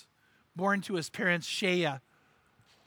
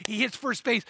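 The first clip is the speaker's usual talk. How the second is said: usual speaking voice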